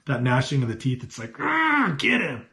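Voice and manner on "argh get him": sudden high growl then a shouted get him; hammed-up furious crowd cry; mock angry mob yell. Preacher acting out the furious mob, mock yell. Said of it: "that's what I envision".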